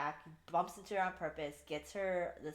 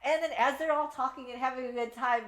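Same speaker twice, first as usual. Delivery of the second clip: normal talking voice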